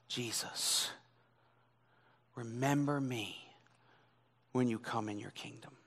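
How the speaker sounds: the thief's plea, breathed out softly; barely voiced, a dying man asking; hushed plea. Preacher voicing the thief's plea, hushed and soft. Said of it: "where he cried out".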